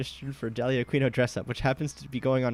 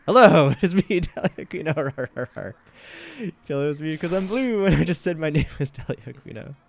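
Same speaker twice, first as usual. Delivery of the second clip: higher, loud bubbly Dahlia introduction; laughing, lightly higher Dahlia; 3 semitones higher, 7 dB louder than usual. Acted. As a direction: announce yourself like a celebrity. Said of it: "here she comes now".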